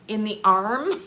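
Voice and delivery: ordinary talk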